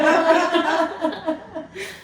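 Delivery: long high laugh. Real, not acted. Host reacting to friends' pun, long high laughter. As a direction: let a high laugh run on.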